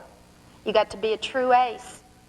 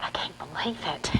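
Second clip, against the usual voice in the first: soft, kind whisper; gently, full of pity; 3 semitones lower, 7 dB quieter than usual. Acted. Verna pities the supposed orphan, softly. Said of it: "real kind-like".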